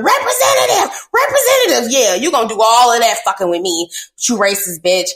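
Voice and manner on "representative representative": high, loud repeated yell; yelling at phone menu. Retold or imitated. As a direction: shout it at the automated line. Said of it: "You yelling, screaming".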